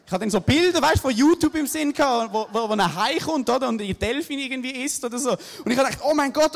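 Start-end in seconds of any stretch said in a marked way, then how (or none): 6.1-6.6 loud, high oh my god in panic; panicked exclamation. Retold or imitated. Surfer paddling away from imagined shark, loud panicked cry. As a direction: cry out in mock terror.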